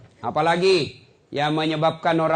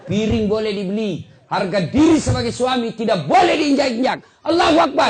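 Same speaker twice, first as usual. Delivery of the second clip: louder, higher, building to a shout; rally the crowd, ending on a ringing takbir; preacher fires up the men, then cries Allahu Akbar; fiery preaching; 8 semitones higher, 9 dB louder than usual. Acted. Preacher rising to a climax.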